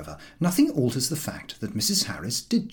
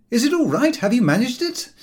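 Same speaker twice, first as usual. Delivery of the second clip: high, loud cheery George; George, cheerful; 13 semitones higher, 6 dB louder than usual. A narrator's reading. George asking if they got leave, cheery. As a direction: ask with breezy cheer.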